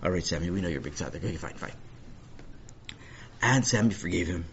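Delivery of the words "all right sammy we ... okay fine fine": low, soft, quick concession; muted, resigned agreement; weary humouring. Acted. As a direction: give in under your breath to humour them. Acted family humouring boastful boy.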